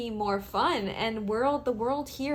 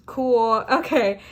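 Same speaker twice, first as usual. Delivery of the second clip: 1 excited; 9 dB louder, slower than usual. Real, not acted. Excited after the reading.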